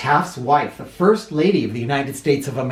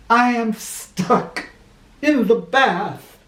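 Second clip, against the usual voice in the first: slow, higher admission of being stuck; sheepish confession; 9 semitones higher, much slower than usual. Acted. President confessing to his wife, slow and sheepish. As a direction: own up slowly and embarrassed. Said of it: "said Taft".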